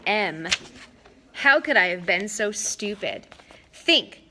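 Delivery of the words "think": urgent self-command. A narrator's reading. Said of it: "I told myself".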